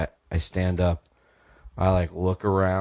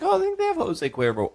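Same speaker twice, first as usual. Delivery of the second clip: much higher, silly put-on voice; mock reply. Answering the roommate about orange tequila in a high silly voice. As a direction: toss it off in a goofy high voice. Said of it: "And I was like".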